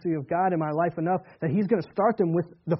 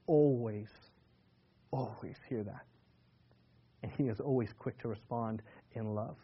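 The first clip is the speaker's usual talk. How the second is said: much softer, lower close; soft reassurance; 5 semitones lower, 7 dB quieter than usual. Real, not acted. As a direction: let the comfort land quietly. Preacher softens on God's love.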